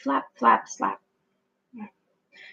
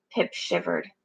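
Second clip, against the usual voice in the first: plain reading voice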